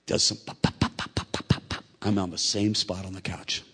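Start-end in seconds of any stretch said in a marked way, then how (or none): 0.4-1.8 voiceless, quick lip pops; imitated popping noise. Father mimics his son popping a week later, steady pops. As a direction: pop it out evenly without voice.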